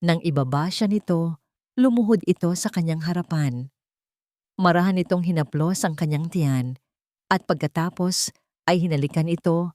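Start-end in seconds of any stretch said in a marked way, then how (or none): none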